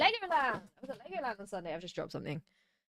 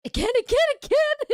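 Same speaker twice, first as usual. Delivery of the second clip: eager call for more, high and loud; excited; 13 semitones higher, 9 dB louder than usual. Real, not acted. Streamer excitedly begging cohost to do it again. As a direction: cheer for an encore. Calling for an encore.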